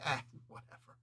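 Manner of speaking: soft, unvoiced-sounding shrug; dismissive. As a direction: toss it away under your breath. Acting out shrugging off embarrassment.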